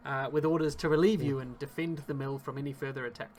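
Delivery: storytelling game master voice; game master narration. Acted. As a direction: set the scene like a dungeon master. Game master describes the scene.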